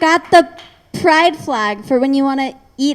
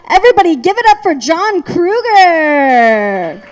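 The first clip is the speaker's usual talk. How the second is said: emcee introduction; 4 semitones higher than usual. Acted. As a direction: hype the crowd for the next act. Emcee introducing next comic.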